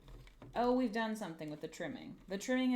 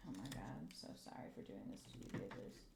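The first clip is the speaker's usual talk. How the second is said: hushed apology; 10 semitones lower, 10 dB quieter, faster than usual. Real, not acted. Apologising to the tiny screws.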